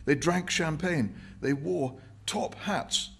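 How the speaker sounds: put-on high, slow old prole voice; old Cockney man.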